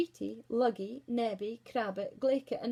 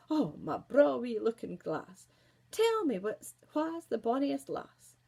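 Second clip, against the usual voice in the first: flattering queen; 3 semitones higher, 7 dB louder than usual. Acted. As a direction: coax the glass grandly. Queen asks mirror again.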